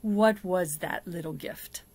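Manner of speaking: ordinary delivery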